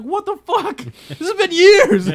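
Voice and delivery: high, loud retold outrage with a laugh; shocked disbelief. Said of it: "And I was like".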